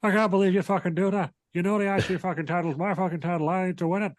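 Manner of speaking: high, hammed-up furious Irish rant; mock angry Irishman. Sheamus rages at Drew over the title, in a high mock-angry Irish voice. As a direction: rant like a cross Irish brawler. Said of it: "Stone Cold Cross with an Irish person".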